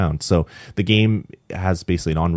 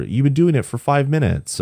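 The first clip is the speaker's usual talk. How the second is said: mock-incredulous goddess; 8 semitones higher than usual. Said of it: "And she's like".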